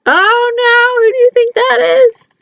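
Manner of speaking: high, fast, frantic fish voice; panicky fish. Acted. Fish cries out in alarm to the kids, high and frantic. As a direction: squeak it out in alarm.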